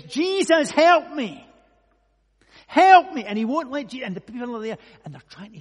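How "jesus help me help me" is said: high, full-voiced cry for help; desperate shout. Blind man calling to Jesus as he passes, shouting loudly. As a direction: shout out as if calling over a crowd. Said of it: "And he shouts".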